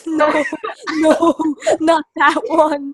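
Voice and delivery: loud laughter breaking through the words; can barely get the words out for laughing; laughing hard. Real, not acted. Friend cracking up at an ugly game sprite, laughing loudly.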